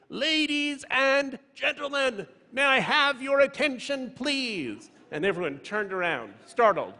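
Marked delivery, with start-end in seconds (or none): 0.1-4.9 put-on high-pitched, drawn-out little-kid announcer voice; small boy's loud announcement. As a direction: imitate a four-year-old grandly calling a room to attention. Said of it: "George said very loudly".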